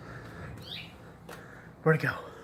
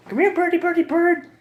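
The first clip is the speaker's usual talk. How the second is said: high coaxing voice; 14 semitones higher, 4 dB louder than usual. Real, not acted. Coaxing a bird out of the house.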